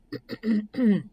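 acted throat-clearing before speaking up; small preparatory throat clear. A narrator's reading. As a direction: clear the throat to get attention. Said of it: "So Wilbur cleared his throat".

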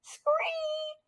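very high, thin screech; mock yell in a tiny high voice; yelling frog. A narrator's reading. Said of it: "he yelled".

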